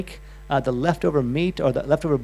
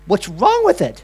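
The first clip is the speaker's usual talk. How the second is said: raised, loud alarmed question; 6 semitones higher, 10 dB louder, slower than usual. Retold or imitated. Asking the butcher, alarmed and loud. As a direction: sound taken aback and worried. Shocked at a yellow chicken.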